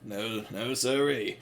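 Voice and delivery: Evening Star answers the diesels, miserable and barely audible; miserable mutter. Retold or imitated. Evening Star, beaten down, answering in a soft miserable voice. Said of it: "she's just miserable".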